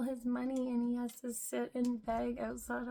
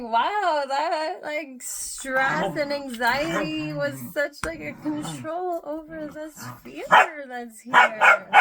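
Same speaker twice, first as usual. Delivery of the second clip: exclaiming; 4 semitones higher, 13 dB louder than usual. Retold or imitated. Retold realisation, much louder.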